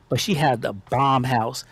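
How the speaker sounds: normal talking voice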